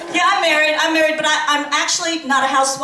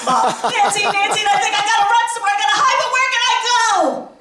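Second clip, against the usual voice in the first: family calls; 7 semitones higher, faster than usual. Retold or imitated. Family calling, her panic.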